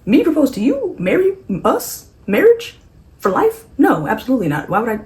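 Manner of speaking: high, loud incredulous yelps, peaking on Marriage; mocking outraged disbelief. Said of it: "And he's like".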